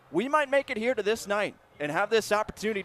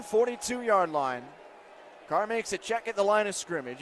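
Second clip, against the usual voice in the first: usual speaking voice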